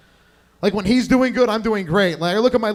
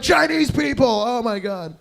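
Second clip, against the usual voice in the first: high shouted cry, then dropping; hammed-up climax shout; mock ecstatic cries; 4 semitones higher, slower than usual. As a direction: imitate a lover yelling in passion. Mimicking dragon's cries in sex.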